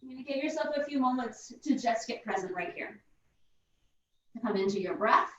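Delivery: guided meditation. Acted. Guided breathing in child's pose.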